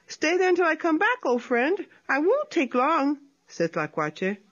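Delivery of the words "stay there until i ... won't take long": a touch higher, sly trickster voice; light sly opossum voice; lightly voiced opossum. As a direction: play the little opossum lightly. Tlacuache voiced in story.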